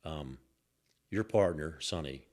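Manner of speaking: low, lightly mimicked Italian-accented goon; heavy Italian accent. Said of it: "with heavy accent".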